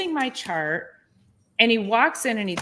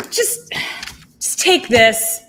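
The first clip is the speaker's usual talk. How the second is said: mock curt order, louder and slow; the patient mimics the doctor ending the talk; dismissive doctor; 3 semitones higher, 7 dB louder, slower than usual. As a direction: lightly imitate a doctor brushing her off.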